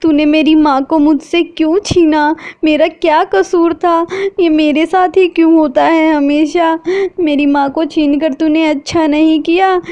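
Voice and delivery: high, grieving plea to God; a wronged daughter wailing at her fate; crying out to God. A narrator's reading. Sheetal cries to God over her mother, grieving. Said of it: "वो फूट फूट कर रोने लगती है".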